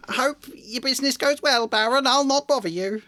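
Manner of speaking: high, oily farewell; fawning poltergeist. A narrator's reading. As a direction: scoot off flattering. Peeves fleeing, fawning.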